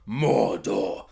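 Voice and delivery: "Mordor" dropped low and ominous; mock dramatic reveal. Parodying the prequel's reveal, low ominous "Mordor". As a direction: say "Mordor" like a cheesy trailer reveal. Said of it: "It is the most prequel thing".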